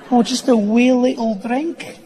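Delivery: put-on high, sing-song Scottish accent with a rise; coaxing, innocent Scottish lilt; tiny-sounding Scottish. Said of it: "Sounds tiny".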